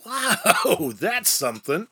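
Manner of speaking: high amazed wow, laughing; a wide-eyed wow, with a laugh. Retold or imitated. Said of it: "start reading and go".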